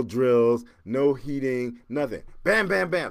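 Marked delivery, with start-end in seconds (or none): 2.4-3.1 quick, high, loud bam-bam-bam; imitated slamming. Storyteller recapping how the safe was pounded, rapid slams. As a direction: rattle off the blows percussively.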